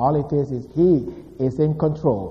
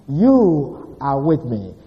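YOU held long and weighty, then falling away; stress on YOU; much slower than usual. Shown as a demonstration. Preacher quoting David on God's protection, stress on YOU. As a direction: stretch the word YOU, slowly finishing the phrase. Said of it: "he's emphasizing the fact".

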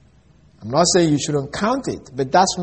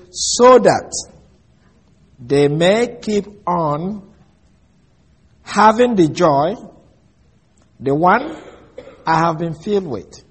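slow, phrase by phrase with long pauses; deliberate, spaced-out scripture reading; slower than usual. Shown as a demonstration. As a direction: read it out slowly, each phrase set apart. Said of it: "read it slowly".

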